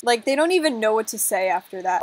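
ordinary talk

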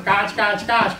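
mock quacking káč káč káč; puppy imitating duck Rachel; playful quack. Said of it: "jako kachna Rachel".

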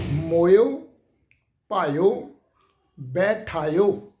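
ਮੋਇਓ, ਭਾਇਓ, ਬੈਠਾਇਓ each with a rising end, ਮੋਇਓ loud; correct pronunciation. Shown as a demonstration. Teacher summing up the correct readings. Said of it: "ਇਸ ਢੰਗ ਦੇ ਨਾਲ ਪਾਠ ਆਏਗਾ".

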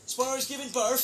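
high, disbelieving question; can't believe the news; surprised question about the pet giving birth. Real, not acted.

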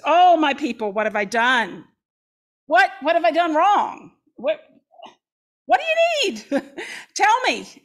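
earnest, pleading, pitch climbing on the questions; pleading Lord's voice. Acted. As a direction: plead with your people like a hurt parent. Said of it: "you hear the Lord's voice".

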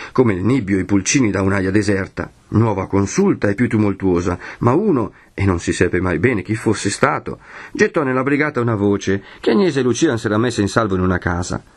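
ordinary delivery

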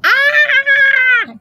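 high loud playful scream; a gleeful child's scare shriek; scariest scream. Acted. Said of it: "I did my scariest scream".

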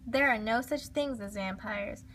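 plain reading voice

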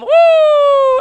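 very high, very loud whoop of joy. Real, not acted. Gamer cheering his teammate's hat trick with a big whoop. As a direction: let out a big celebratory woo.

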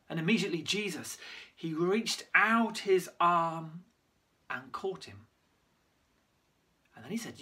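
normal talking voice

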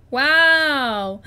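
loud, drawn-out wow; big wow. Real, not acted. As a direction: belt out an amazed wow. Admiring the finished build.

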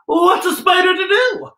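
high, loud spider shout; mock exasperated shout. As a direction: throw the question up in theatrical outrage. Spider's gloating note.

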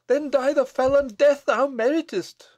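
high, loud, fierce outburst; fierce cry. Acted. Bess condemns the abbot to the gallows, fierce cry. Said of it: "cried Bess fiercely".

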